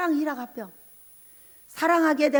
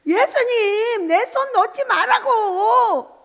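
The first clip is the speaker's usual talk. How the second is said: loud, high, drawn-out whine, imitating tearful pleading; mock crying wail; 8 semitones higher, 7 dB louder, much slower than usual. Preacher re-enacting her tearful plea to Jesus, mock crying wail. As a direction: wail it slowly like a bawling child, hammed up. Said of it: "제가 막 울어요".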